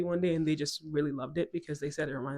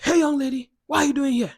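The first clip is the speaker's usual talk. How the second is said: loud, higher officer calling out; mock cop hailing the kid, louder; police officer; 9 semitones higher, 10 dB louder than usual. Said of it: "He's like".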